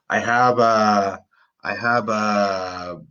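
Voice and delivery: "have" said slowly, teeth on the lip for the v. Shown as a demonstration. Teacher drilling learners, slow careful "have". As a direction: drag out "have" so the v shows. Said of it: "saying the word".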